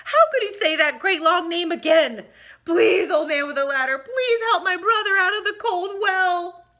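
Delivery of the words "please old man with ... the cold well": pleading. Acted. Child pleading.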